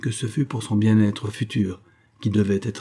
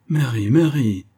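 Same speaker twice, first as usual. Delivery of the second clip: higher, louder chiding call; reproachful; 4 semitones higher, 5 dB louder, slower than usual. A narrator's reading. Father chiding his daughter by name, reproachful. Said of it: "dit-il d'un ton de reproche".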